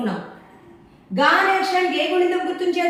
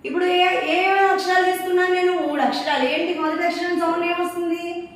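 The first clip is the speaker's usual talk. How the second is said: normal talking voice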